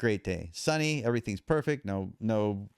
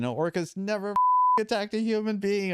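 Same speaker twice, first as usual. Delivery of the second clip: high-pitched yell, not much louder; retold yell; 10 semitones higher, 3 dB louder than usual. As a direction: re-enact yelling at an orca in a raised, strained pitch. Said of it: "I'm yelling".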